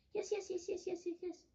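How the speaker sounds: high, excited run of yeses. Retold or imitated. Boy told he can help all day, high and excited. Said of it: "I said it so loud".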